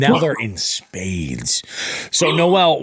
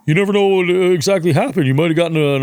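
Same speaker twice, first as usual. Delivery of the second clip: mock hippie voice; 7 semitones higher, faster than usual. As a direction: play the forgiving hippie giving the benefit of the doubt. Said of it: "that fucking hippie that's like".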